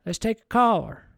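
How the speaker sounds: a game-show anchor bringing in the phones; TV host announcing. Pretend. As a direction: announce the caller like on air. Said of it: "And then I'd say".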